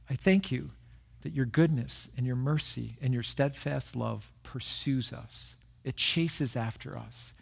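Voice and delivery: hushed, earnest prayer voice. Acted. Opening prayer before sermon.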